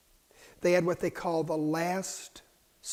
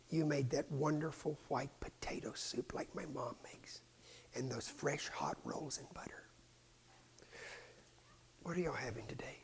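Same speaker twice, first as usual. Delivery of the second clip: whispered memory of a meal; hushed whisper; 11 dB quieter than usual. Acted. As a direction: lean in and whisper it. Intimate aside about the meal.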